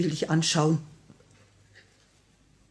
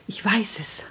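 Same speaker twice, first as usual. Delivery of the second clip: whispered. Acted. Widow whispering to the assassin. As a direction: confide it under the breath.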